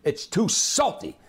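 high, slow furious owner; a hammed-up angry restaurant owner; yelling at the chef. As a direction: blast the chef with it. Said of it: "he said to the chef".

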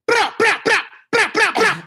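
high-pitched bursts of mock gunshots; imitated gunfire. Storyteller mimicking the drive-by outside his house, gunfire bursts. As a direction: bark out rapid shots with the mouth.

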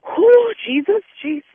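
sharp loud whew blowing off steam; loud exhale. Acted. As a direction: let out a big loud whew. Outburst while holding in anger.

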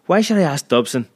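indignant cry. Acted. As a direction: demand it as an offended headmaster. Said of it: "he cried".

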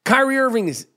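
usual speaking voice

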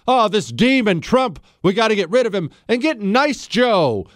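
mock their sales pitch. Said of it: "how they tried to sell Joe Biden".

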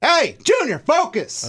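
high-pitched, loud sideline yell; demonstrated parent yell, FOCUS pitched high; yelled at kid. Shown as a demonstration. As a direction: call out sharply to a kid on the field. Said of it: "You'll yell that?".

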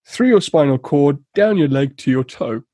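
ordinary talk

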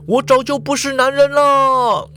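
lightly voiced self-pity; Ikeda crying back. A narrator's reading. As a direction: wail it over his shoulder.

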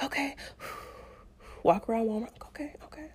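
very soft, breathy self-talk; sigh, then barely voice it; hushed coping through a contraction; breathing through it. Retold or imitated. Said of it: "I'm just breathing through it".

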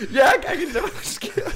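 high, loud, laughing through the words; laughing mock boast. Teammate back from sprints, laughing his way onto the scale. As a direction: crack up while claiming you're ready.

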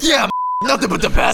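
high mock boss voice, sucking up; boss's voice. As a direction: parrot the manager's catchphrase up high. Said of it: "in the same fucking voice that he's saying it".

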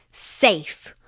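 ordinary delivery, nothing marked

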